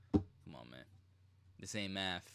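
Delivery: usual speaking voice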